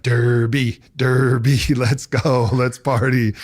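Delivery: mock-excited old self chanting Derby; old party self. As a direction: hype yourself up like a party animal. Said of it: "which old me would have been like".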